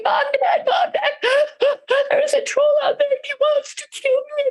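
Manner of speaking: high, hammed-up terrified wailing; crying. A narrator's reading. Second brother runs home to parents, crying in terror. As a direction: bawl it out like a frightened boy. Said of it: "ran home crying".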